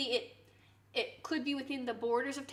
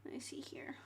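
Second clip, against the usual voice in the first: soft unvoiced whisper to herself; hushed aside; 14 dB quieter than usual. Real, not acted. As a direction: whisper it as you read. Muttering while reading chat.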